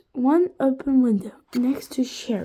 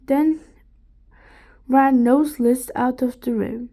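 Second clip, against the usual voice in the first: ordinary delivery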